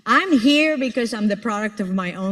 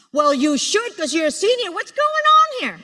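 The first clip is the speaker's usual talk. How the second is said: mock scolding; 10 semitones higher than usual. Teasing a student about philosophy.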